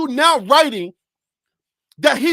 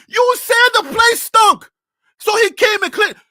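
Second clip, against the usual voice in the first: exasperated shout; 8 semitones higher, 4 dB louder than usual. Real, not acted. Exasperated at the writers.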